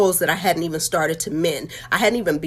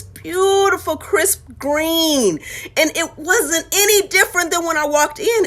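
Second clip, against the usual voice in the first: awed; 8 semitones higher than usual. Real, not acted. Emotional memory of bright colours.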